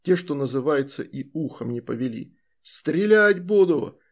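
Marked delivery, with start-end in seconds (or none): 2.6-4.0 slow furious threat, loud; furious shout. Acted. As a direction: yell it in a rage. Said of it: "в бешенстве закричал Красуляк".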